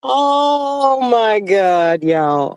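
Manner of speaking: slow, high oh my God in disbelief; drawn-out disbelief. Real, not acted. To the viewers about the drama, slow high disbelief. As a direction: stretch it out, can't believe it.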